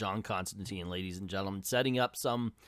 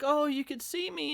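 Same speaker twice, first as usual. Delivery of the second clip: delighted Abby. Acted. Abby's delighted line to Alec.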